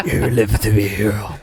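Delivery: low gruff attempt through laughter; Batman. Podcast host tries the movie line for friends, Batman impression. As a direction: put on a deep hero voice, cracking up. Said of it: "Batman voice".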